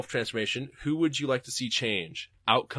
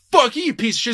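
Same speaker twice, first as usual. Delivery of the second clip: higher, louder hammed-up cursing; mock angry retort; 11 semitones higher, 10 dB louder, faster than usual. Fired worker cursing at the boss, hammed-up anger. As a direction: spit it back at the boss in mock fury. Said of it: "And you're like".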